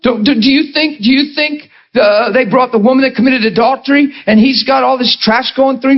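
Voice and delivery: ordinary delivery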